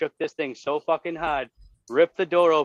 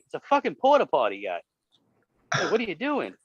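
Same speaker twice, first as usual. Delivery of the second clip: exasperated yell; 8 semitones higher than usual. Retold or imitated. Yelling at the homeless guy.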